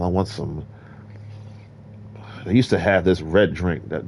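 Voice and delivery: normal talking voice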